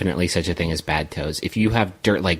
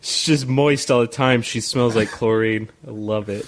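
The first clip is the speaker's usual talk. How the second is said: creepy turned-on guy; 2 semitones higher than usual. Pretend. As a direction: play a lecherous bro. Voicing a horny guy.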